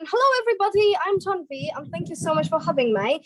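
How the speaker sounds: posh-accented introduction. Shown as a demonstration. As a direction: greet them in a posh English accent. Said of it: "getting on with the posh accent".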